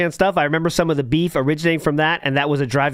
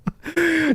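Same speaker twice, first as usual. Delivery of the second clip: high mocking laugh; 13 semitones higher than usual. Laugh inside mockery.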